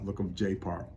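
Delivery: ordinary talk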